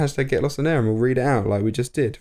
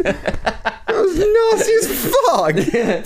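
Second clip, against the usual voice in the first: laughing; much slower than usual. Real, not acted. Reacts to mean impression, laughing.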